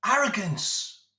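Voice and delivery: slow, scornful single word; scornful verdict. Real, not acted. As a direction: spit out the verdict slowly.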